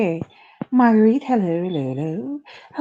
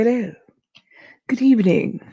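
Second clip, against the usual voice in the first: ordinary talk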